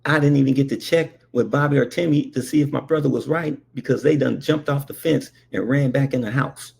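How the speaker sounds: ordinary talk, nothing marked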